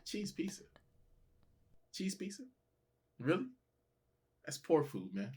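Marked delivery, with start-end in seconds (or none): 0.0-3.5 high, soft scornful repeat; mock disdain. Mocking people who order plain cheese pizza, disdainful. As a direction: sneer at the very idea.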